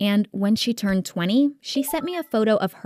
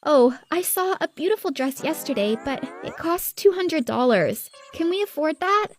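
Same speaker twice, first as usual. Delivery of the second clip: high-pitched coy princess; wheedling spoiled daughter; 6 semitones higher than usual. A narrator's reading. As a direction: angle for money in a high little voice. Spoiled sister angles for money.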